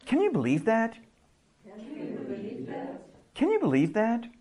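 high, disbelieving question; incredulous. Shown as a demonstration. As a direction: sound amazed at the news. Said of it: "You're gonna repeat it".

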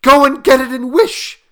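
high, loud frantic command; shrieked, urgent order; quivering with excitement. A narrator's reading. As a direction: cry it out, wild with excitement. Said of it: "quivering with excitement".

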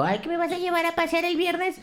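high, soft mock voice of mom asking to be taken out; imitating mom's high, pleading request; mother's voice. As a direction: put on a sweet pleading mom voice. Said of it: "entonces dijo".

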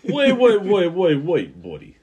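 loud, high waits dropping on buddy; mock urgent objection. Second gambler halting the other over a flush, loud mock protest. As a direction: cut in with play-acted alarm. Joins the acted casino dispute.

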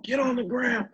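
high-pitched imitation of a yelling cop; mock yelling. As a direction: mimic a panicked officer shouting orders. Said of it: "Now he's yelling".

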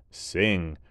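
ordinary delivery

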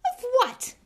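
high, loud sneer; scoffing. A narrator's reading. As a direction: scoff at him. Malfoy scoffing.

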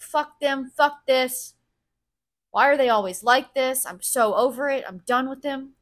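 loud, exasperated venting, quoting oneself; retold angry complaining. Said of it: "I fucking complained about them".

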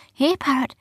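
high sing-out to a pet; coaxing. A narrator's reading. Rowan lures the parrot with fruit, high coaxing.

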